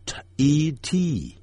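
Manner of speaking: T E T t spelled letter by letter, slow; spelling out slowly. Shown as a demonstration. Teacher spelling the start of teacher. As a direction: drawl out each letter then the t sound. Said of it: "就要念成ch".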